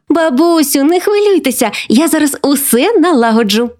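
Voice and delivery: put-on high-pitched girl voice; eager granddaughter. Granddaughter promising grandma to fix everything, eager and high. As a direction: reassure her with bright childish confidence.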